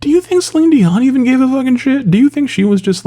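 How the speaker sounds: very high-pitched, incredulous squeal of a question. Real, not acted. Vlogger asking if the snubbed singer even cared, incredulous. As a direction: pitch it way up in exasperated disbelief.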